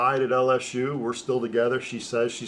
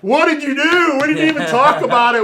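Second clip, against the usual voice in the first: much higher, louder, incredulous parent voice; mock-shocked parents; 12 dB louder than usual. Parents reacting to their son committing without them, hammed-up disbelief. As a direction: play the outraged parent, squealing in disbelief. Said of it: "My parents were like".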